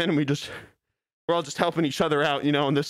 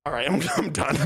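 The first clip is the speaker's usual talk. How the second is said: usual speaking voice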